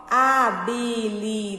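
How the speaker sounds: "habilidade" slowly split into five syllables; divisão silábica. Shown as a demonstration. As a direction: sound out "habilidade" one syllable at a time. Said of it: "Quantas sílabas tem essa palavra?". ("habilidade" pausado, sílaba por sílaba)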